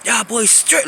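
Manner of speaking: put-on cry-shout at the boys in frustration; yelling while crying. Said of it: "you yelling while you crying".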